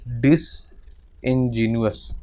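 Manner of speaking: डिसइंजीनियस drawn out slowly while working it out; slow spelling out. Shown as a demonstration. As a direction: stretch डिसइंजीनियस out slowly syllable by syllable. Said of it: "drawn-out spelling of the word".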